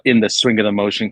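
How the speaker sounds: ordinary talk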